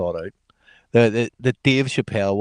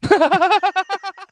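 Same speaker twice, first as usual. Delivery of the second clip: loud high laugh; 7 dB louder than usual. Real, not acted. Watching a one-armed guitarist clip, loud high laughter.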